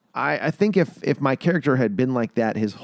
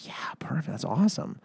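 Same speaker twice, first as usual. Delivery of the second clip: whisper; 4 semitones higher, 6 dB quieter than usual. Retold or imitated. Director approving, whispered.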